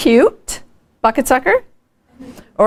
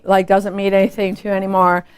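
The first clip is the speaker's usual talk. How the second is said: normal talking voice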